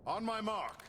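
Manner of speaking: rally the troops to strike now; shouted order. Acted. Leader shouts to his troops to attack.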